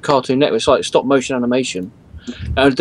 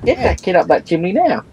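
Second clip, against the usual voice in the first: mock Cockney accent; 5 semitones higher than usual. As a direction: bark it like a chimney-sweep scold in a hammed-up Cockney.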